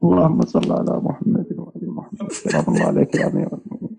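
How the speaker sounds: whispered, choked greeting to the Commander of the Faithful; real weeping whisper; tearful whisper. Real, not acted. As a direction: whisper it as if holding back tears. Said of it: "أشعر أنك تبكي معي".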